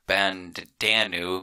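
bandanu said slowly, syllable by syllable; careful Hindi word. Shown as a demonstration. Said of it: "the Hindi word".

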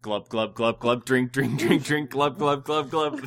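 low gulping glub noises; gurgling fish man croak. Pretend. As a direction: gulp it out like a fish. Mocks drinking mid-sentence.

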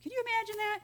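ordinary talk, nothing marked

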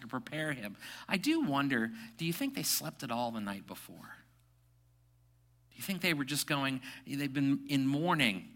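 ordinary delivery

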